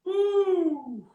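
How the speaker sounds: long, high-pitched "woo"; high whoop. Real, not acted. Host reacting to talk of a whole other ballgame, high whoop. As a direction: let out a drawn-out high whoop.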